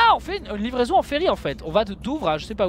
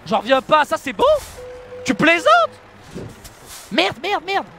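outraged disbelief; 8 semitones higher, 5 dB louder than usual. Real, not acted. Disbelief at a failed parking.

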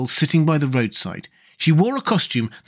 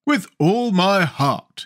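high-pitched, slow, emphatic assent; heartfelt exclamation; 7 semitones higher, much slower than usual. A narrator's reading. Jack accepts the king's friendship, exclaiming heartily. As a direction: agree wholeheartedly, stretching the words. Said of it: "exclaimed Jack".

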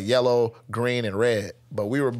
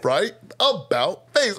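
drill command; 5 semitones higher, slower than usual. Retold or imitated.

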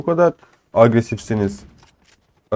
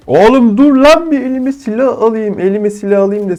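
much higher, louder exasperated yell; yelling at unfair attackers; frustrated shout; 9 semitones higher, 3 dB louder than usual. Real, not acted. Gamer yelling at enemies while unarmed, frustrated. Attacked before grabbing a gun.

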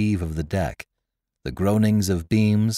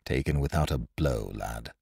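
low, much softer, bitter murmur; 8 semitones lower, 9 dB quieter than usual. A narrator's reading. Ned Low to George in the dark lazarette, soft bitter murmur. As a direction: let the words sink in low and bitter. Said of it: "His voice was bitter".

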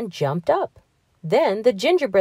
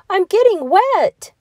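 high, loud worried cry; 7 dB louder than usual. A narrator's reading. Gingerbread man cries out to the fox about the water. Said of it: "said the gingerbread man".

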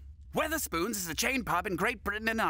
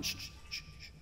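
shushing; 11 dB quieter, faster than usual. Acted.

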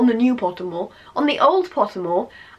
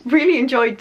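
ordinary talk

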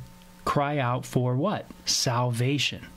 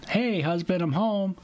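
lightly acted, higher-pitched wife greeting her husband; mock homecoming greeting; 6 semitones higher than usual. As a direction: playfully call out a pretend arrival home. Acts returning wife greeting husband.

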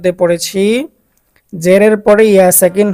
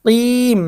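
কিম pitched higher with a drawn-out vowel; stretched কিম; 4 semitones higher, much slower than usual. Shown as a demonstration. Teacher pointing out the three-alif stretch, drawn-out chanted কিম. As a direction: lengthen the vowel of কিম on a raised note. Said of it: "তিন আলিফ পরিমাণ টান হবে".

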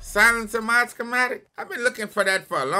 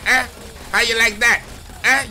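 gloating taunt; 4 semitones higher, 6 dB louder than usual. Real, not acted. Taunting enemies while fighting.

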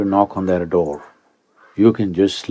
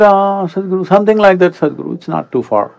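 higher, a touch evasive; hedging experts; 9 semitones higher than usual. Retold or imitated. Experts evasively answer Sadhguru, in a higher hedging voice. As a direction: imitate reluctant experts dodging. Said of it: "They said".

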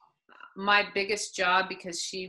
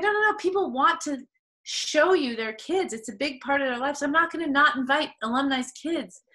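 high-pitched retold objection; protesting; 7 semitones higher, 4 dB louder than usual. Coach refusing her player's request about kids, retold protest. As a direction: push back insistently, as if replaying the argument.

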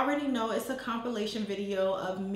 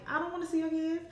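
higher-pitched mock complaint; imitated reluctant listener; 7 semitones higher, 3 dB quieter, much faster than usual. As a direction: put on an uninterested person's higher voice. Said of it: "and you like".

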